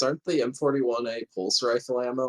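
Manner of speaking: ordinary delivery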